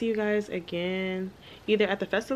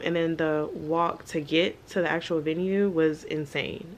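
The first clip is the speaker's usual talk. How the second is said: usual speaking voice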